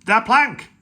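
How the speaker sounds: loud, high pirate yell; loud pirate yell demanding the plank. Acted. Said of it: "yelled Ben Buckle and Percy Patch".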